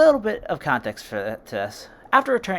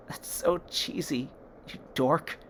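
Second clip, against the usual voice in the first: softer, slow teary tease; tearful; 7 dB quieter, slower than usual. A narrator's reading. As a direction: laugh at him through tears.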